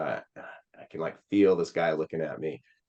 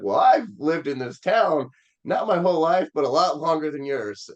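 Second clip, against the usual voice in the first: wry driver; 8 semitones higher, 4 dB louder than usual. Retold or imitated. Driver voiced in retold story.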